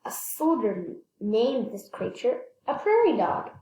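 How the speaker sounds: usual speaking voice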